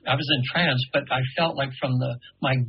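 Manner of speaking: normal talking voice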